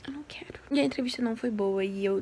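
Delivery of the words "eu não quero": unvoiced whisper, tired and unwilling; whispered reluctance. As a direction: breathe it out, not wanting to go on. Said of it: "Eu tava assim". (sussurro cansado e relutante)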